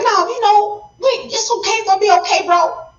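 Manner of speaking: Enki reassuring. Retold or imitated. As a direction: shrug it off, calming his brother down. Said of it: "Enki was like".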